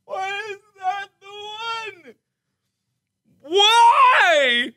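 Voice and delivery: high, strained laughing complaint; crying with laughter, asking why; laughing protest. Real, not acted.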